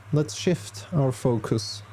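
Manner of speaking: ordinary talk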